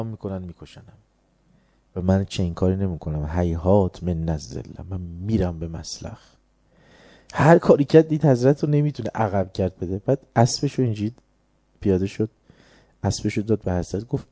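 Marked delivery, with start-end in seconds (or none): none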